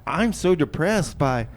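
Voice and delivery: ordinary talk, nothing marked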